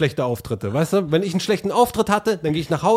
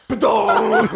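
ringing "bedong" clang; drawn-out comic impact noise; imitated needle drop; 3 dB louder than usual. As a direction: mimic a tiny needle landing with a huge resounding boom. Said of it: "die Nadel hätte so gemacht".

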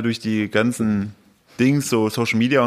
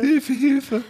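very high mock cry for help; squeaky cry for help; 14 semitones higher than usual. Voicing the burglar.

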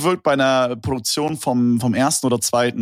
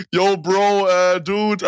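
raised, hyped bro talk; 6 semitones higher, much slower than usual. Retold or imitated. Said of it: "die reden dann so einfach so wie ich".

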